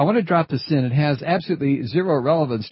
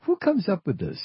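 incredulous. Real, not acted. Mocking the word tweet.

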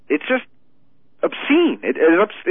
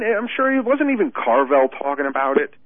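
ordinary talk, nothing marked

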